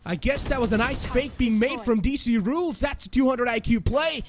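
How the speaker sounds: esports caster. Real, not acted. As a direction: build the hype through the clutch. Clutch round called live.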